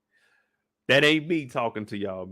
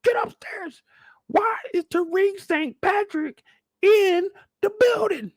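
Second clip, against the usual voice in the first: shrill-voiced angry dad hollering; dad yelling upstairs. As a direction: yell up the stairs at your son. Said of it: "His pops calling upstairs like".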